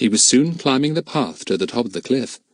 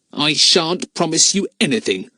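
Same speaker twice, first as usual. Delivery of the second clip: put-on defiant boy voice; 6 semitones higher than usual. Boy defies his captor.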